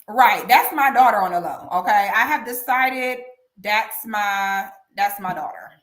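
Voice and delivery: ordinary talk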